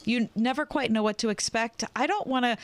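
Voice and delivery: ordinary talk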